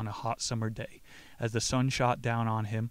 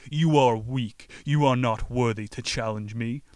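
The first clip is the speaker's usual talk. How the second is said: hammed-up sneering demon; demon taunt; 7 dB louder than usual. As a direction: belittle him, slow and loud on weak. Demon taunts the young man.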